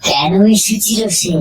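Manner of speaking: plain reading voice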